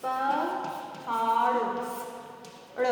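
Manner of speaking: पहाड़ drawn out very slowly, ending on a flapped ड़; stretched flap ड़. Shown as a demonstration. Teacher stretching पहाड़ for pupils, very slow. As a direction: linger on पहाड़ so the flap is heard. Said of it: "इसका उच्चारण ड़ हो रहा है".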